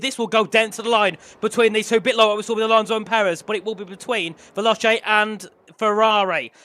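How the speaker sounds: energetic motorsport play-by-play; live race commentary. Real, not acted. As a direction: call the positions with urgency.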